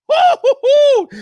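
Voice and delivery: a loud high woo-hoo-hoo whoop, slow and stretched; high excited whoop. Real, not acted. Streamer hyping his live chat with a high woo-hoo-hoo whoop. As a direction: whoop it up like a hype man.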